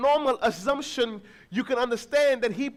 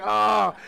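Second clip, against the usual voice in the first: loud, proud strongman roar; loud flex shout; 8 dB louder than usual. Retold or imitated. Father flexing alongside his son, loud roar. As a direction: roar like showing off big muscles. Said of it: "I'm like".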